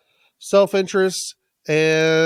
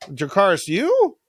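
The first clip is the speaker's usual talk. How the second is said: puzzled dragon. Acted. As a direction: echo the command like a clueless dragon. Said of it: "I don't understand".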